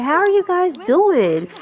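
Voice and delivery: high, drawn-out hello in character; chirpy greeting. Pretend. Game character greets the girls, chirpy high voice.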